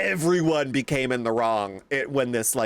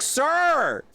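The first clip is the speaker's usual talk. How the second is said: high exasperated appeal to dad; exasperated protest; 14 semitones higher, slower than usual. Retold or imitated. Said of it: "I was like".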